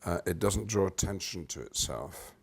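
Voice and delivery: normal talking voice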